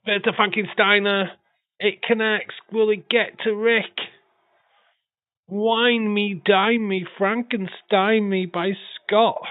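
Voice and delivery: high and loud hype call; excited commentary. Real, not acted. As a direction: sell the big move with excitement. Hyped commentary on big move.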